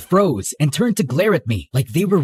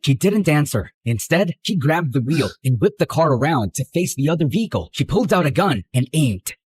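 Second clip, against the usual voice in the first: usual speaking voice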